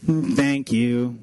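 low, slow, reluctant thanks; unthankful kid. Retold or imitated. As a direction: imitate a child grudgingly being polite. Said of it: "not something they're very thankful for".

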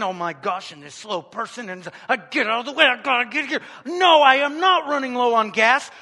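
a harried driver talking to herself; imitating a stressed-out commuter, rising in pitch. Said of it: "but you're so stressed".

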